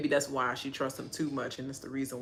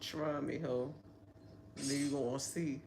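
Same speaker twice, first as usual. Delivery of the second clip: softer, low, menacing; low threat; 3 semitones lower, 11 dB quieter than usual. Pretend. Vlogger imagines answering Taylor, quiet low threat. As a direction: quietly dare them. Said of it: "I'd have been looking like".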